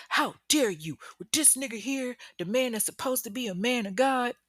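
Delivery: high-pitched mock indignation; acted outrage. Jealous ex objects to the pastor, mock outrage. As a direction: imitate an affronted man, without shouting. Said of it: "but Brian is pissed".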